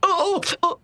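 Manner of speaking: repeated high "ow" yelps; high-pitched pained yelping. Acted. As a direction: cry out sharply in pain, several times. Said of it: "बहुत दर्द हो रहा है".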